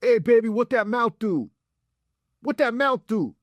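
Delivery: high-pitched hammed-up catcall; cartoon catcaller yell. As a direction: holler it like a cartoon dude from a car window. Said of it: "hanging out the window yelling".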